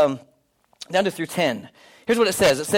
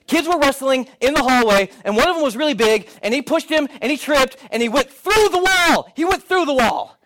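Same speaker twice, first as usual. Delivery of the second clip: higher, breathless rush of alarm; agitated retelling; 6 semitones higher than usual. Retold or imitated. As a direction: blurt out the accident in alarm. Helper retells accident, agitated.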